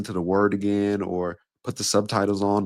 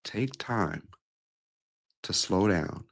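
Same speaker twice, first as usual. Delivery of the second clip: softer, drawn out; slowing down; 6 dB quieter, slower than usual. Shown as a demonstration. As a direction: let it drop and stretch out. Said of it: "I just slowed down".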